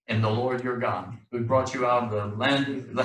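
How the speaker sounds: ordinary talk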